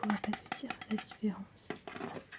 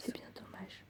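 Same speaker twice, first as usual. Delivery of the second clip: very soft whisper; ASMR whisper; 9 dB quieter, slower than usual. Acted. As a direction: whisper it gently.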